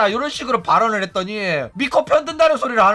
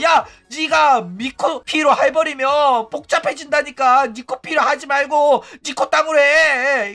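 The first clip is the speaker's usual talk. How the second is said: 후부키 yelling to stop; 5 semitones higher than usual. Retold or imitated. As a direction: shout it like urgently calling someone off. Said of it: "이러니까 후부키가". (높고 크게 말리며 외치는 흉내)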